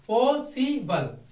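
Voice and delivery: पॉसिबल slowly, पॉ सि बल spaced out; syllable by syllable. Shown as a demonstration. Teacher showing syllables of the example word, slow and spaced. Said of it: "तीन हिस्सों में तोड़ा".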